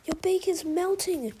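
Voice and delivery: alarmed crows' outcry; crying out. A narrator's reading. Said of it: "the crows cry".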